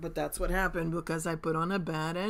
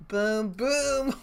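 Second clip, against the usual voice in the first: gleeful, punchy and louder; higher, louder little victory cry; excited boom; 6 semitones higher, 6 dB louder than usual. Real, not acted. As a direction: celebrate the quick win out loud. Trade pays off live.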